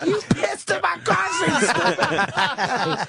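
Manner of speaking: high yell cracking into laughter; retelling a friend's rage while cracking up; laughing mock yell. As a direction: try to shout it but laugh through. Retelling Keith's angry reaction.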